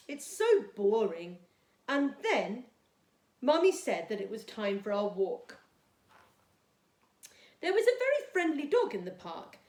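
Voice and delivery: put-on storytime reading for young children; gentle storybook read-aloud for Year two; reading a story. A narrator's reading.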